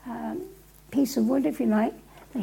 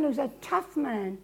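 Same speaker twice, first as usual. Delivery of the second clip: ordinary talk, nothing marked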